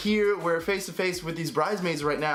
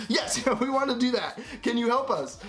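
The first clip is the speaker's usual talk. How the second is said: eager pleading; 8 semitones higher than usual. Retold or imitated.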